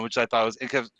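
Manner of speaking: ordinary talk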